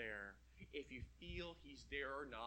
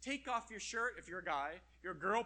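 normal talking voice

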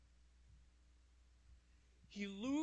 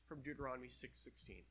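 ordinary delivery

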